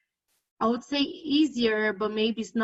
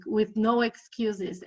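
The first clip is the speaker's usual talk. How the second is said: ordinary talk, nothing marked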